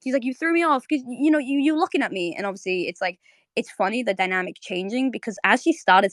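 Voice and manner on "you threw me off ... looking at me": high-pitched imitated complaint; mock pissed off. Marceline accusing Bubblegum of watching her, mock annoyance. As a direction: blame the other person, flustered. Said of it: "she's really pissed off".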